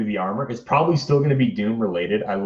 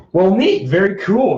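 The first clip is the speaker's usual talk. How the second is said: excited; 11 semitones higher, 6 dB louder than usual. Real, not acted. Pleased with finished damage.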